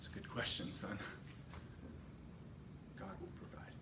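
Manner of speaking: very quiet, half-whispered answer; hushed fatherly reply. Acted. Abraham answering Isaac on the trek up the hill, hushed. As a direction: answer softly, almost under the breath.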